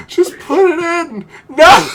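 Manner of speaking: high, loud wail building to a yell; exasperated cry. Real, not acted. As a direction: beg the ball, then explode. Frustrated at missed putt.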